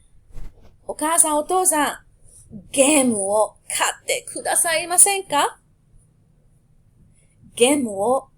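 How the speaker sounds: put-on child begging parents for a new game at Christmas; pleading child. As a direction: wheedle mom and dad for the game. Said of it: "the phrase you'll be saying to your parents".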